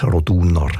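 "Roduner" stressed on the middle syllable, DU; second-syllable stress. Shown as a demonstration. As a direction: say "Roduner" as ro-DU-ner. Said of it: "die zweite Silbe, die betont".

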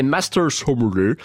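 Master Sommelier in a slow, cheap mock English accent; drawn-out fake English pronunciation of Master Sommelier. Shown as a demonstration. Host naming the prestigious British title, cheap English accent. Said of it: "je le prononce avec cet accent, euh, anglais à deux francs cinquante".

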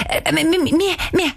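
high, flustered stutter; stammering. A narrator's reading. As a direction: trip over the first word again and again. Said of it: "Danisa stottert". (höch und verläge gstaggelet)

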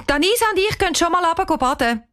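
put-on high, quick girl's voice; announcing girl. Girl telling aunt they're off swimming, announcing. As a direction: call it out fast and high.

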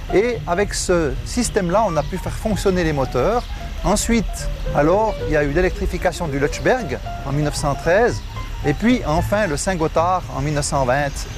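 usual speaking voice

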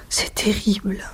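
a hushed first "terrible"; whispered "terrible". A narrator's reading. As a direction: breathe "C'est terrible" under the breath. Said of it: "murmura-t-il".

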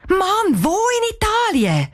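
sehr hoher, genervter Aufschrei; lauter Ausruf. A narrator's reading. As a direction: ruf die Frage aufgebracht heraus. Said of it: "Cheyenne ruft laut raus". (aufgebrachtes Herausrufen)

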